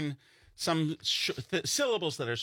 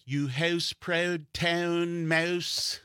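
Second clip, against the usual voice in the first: put-on very slow, higher Canadian accent on every "ou"; 7 semitones higher, much slower than usual. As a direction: drawl the "ou" vowels like a Canadian. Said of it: "the Canadian accent".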